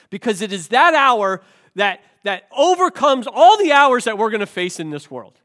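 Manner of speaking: rousing preacher at full force; preaching climax. Real, not acted. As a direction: drive it home from the pulpit, full voice. Sermon climax, rising intensity.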